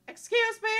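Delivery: put-on high, bright young princess, wistful; a lovely princess doubting fairy tales; princess Amelia voice.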